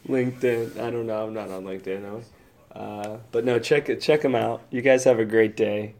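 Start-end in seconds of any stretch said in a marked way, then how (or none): none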